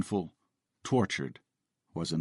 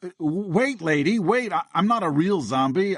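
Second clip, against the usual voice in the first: high, loud flustered plea; flustered pleading; 10 semitones higher, 4 dB louder than usual. A narrator's reading. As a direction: call after her, flustered. Zombie chases scared shopper.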